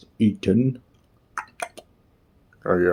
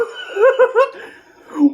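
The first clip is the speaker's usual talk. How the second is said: high laugh; 4 dB louder than usual. Real, not acted. Reacting to swear word.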